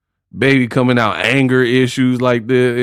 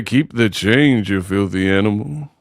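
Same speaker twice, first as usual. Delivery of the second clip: Home Alone gangster; 5 semitones lower than usual. Retold or imitated. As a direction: drag out the famous tough-guy line low. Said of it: "talking like that".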